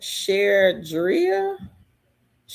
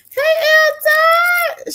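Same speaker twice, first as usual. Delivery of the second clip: much higher, louder and slow; drawn-out high greeting; 7 dB louder, much slower than usual. Real, not acted. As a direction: stretch out a happy hello. Greeting a viewer.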